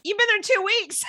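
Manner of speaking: high mock-exasperated shout; loud, high retold outburst; incredulous. Retold mother's disbelief at daughter.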